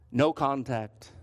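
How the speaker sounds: normal talking voice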